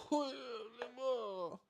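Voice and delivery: high bubbling laugh; high giggle. Real, not acted. Laughing at Italian pizza videos, high giggle.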